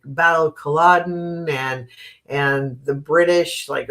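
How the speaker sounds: ordinary delivery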